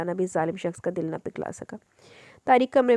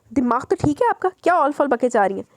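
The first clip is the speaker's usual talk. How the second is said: higher, louder outraged retort; heated retort; 6 semitones higher, 5 dB louder than usual. Acted. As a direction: throw it back at her in disbelief. Heated quarrel with the madam. (ऊँची, तीखी नाराज़ फटकार)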